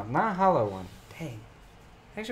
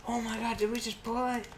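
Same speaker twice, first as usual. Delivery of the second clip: quick, high, soft mock amazement; imitated excited thought; 8 semitones higher, 5 dB quieter, faster than usual. Card opener retelling his hope of Charizard, mock excitement. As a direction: replay your inner gasp, high and hushed. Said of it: "In my mind, I was thinking".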